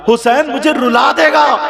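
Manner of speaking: high, loud, choked-up climax; rising emotional cry. Acted. Prophet asking Ali to take the boys, rising cry. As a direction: let the feeling break out loud.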